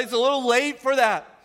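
acted dismissive scoff, high and loud; Sarah. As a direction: wave it off in mock disbelief. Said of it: "she laughs in hopelessness".

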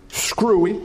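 ordinary talk, nothing marked

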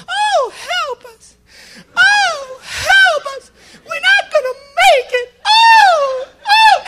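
very high, drawn-out Southern lady wail; mock-helpless Southern damsel crying for help; southern accent. Frightened lady on a chased wagon, hammed-up Southern wail. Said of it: "That's my southern accent".

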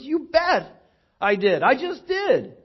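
high, slow, proud declaration; a kid bursting to say he's in; eager kid. Retold or imitated. Kid's eager answer about faith.

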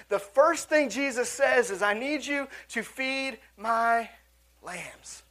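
preacher's slow building stress; slow emphatic climax. Acted. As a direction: lean on every word to drive it home. Preacher stresses the key line.

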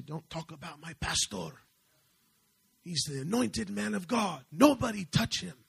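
high, rising defense of someone revered; protective warning. Retold or imitated. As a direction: imitate a loyal man warning others off. Retells man defending his pastor.

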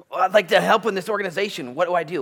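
high, loud would-be helper voice; eager volunteer. Acted. Volunteer offers help to an organization, high and eager. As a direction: pipe up loudly like a keen volunteer.

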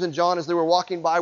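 normal talking voice

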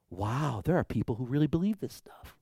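a hushed, amazed aside; whispered thought. Retold or imitated. Walking home, whispering his amazement to himself. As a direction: whisper it to yourself in wonder.

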